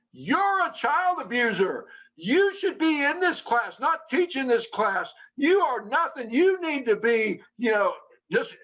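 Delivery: imitating a man who has just jumped up, furious; retold angry outburst.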